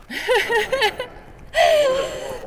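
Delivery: high bright burst of laughter; embarrassed laugh. Real, not acted. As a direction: laugh it off, a little shy about the love story. Confessing love story, embarrassed.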